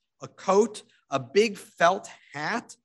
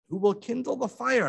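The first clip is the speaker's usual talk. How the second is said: high, plaintive question; weak widow; 8 semitones higher than usual. A narrator's reading. Sick widow asking who will light the fire, plaintive. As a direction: ask helplessly from the bed.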